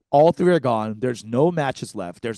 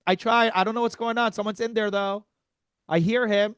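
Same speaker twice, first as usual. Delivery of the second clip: high, flustered cover-up voice, much higher; nervous Eric; 9 semitones higher than usual. Retold or imitated. Friend at the bathroom door nervously covering for her, high and flustered. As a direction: play the anxious friend stalling at the door. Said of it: "Eric's like".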